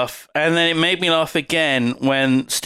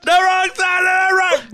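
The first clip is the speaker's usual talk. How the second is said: shouting; 12 semitones higher than usual. Retold or imitated. Echoing crowd yelling at driver.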